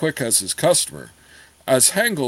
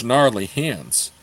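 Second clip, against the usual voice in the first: ordinary delivery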